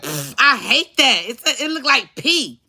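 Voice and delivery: put-on high, drawn-out childlike disgust. Little girl refusing dad's beer, disgusted.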